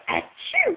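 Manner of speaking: high-pitched pretend "achoo!"; fake sneeze. Shown as a demonstration. Mother coaxing toddler to copy her, playful fake sneeze.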